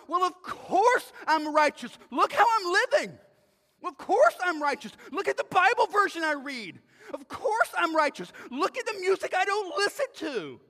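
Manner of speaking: high, loud mock bragging; mock self-righteous boasting. Preacher imitates believers boasting of their righteousness, mock self-righteous. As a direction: boast indignantly, hammed-up and loud.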